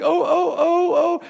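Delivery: high, frightened whimpering; cowering whimper. Acted. Cut-off driver cowering from the trucker, high whimpers. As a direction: whimper like a scared driver in his car.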